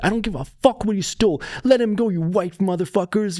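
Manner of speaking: high-pitched, outraged mock yell; imitated yelling. Bystander yelling at the good Samaritans, high mock outrage. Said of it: "starts yelling at the Good Samaritan".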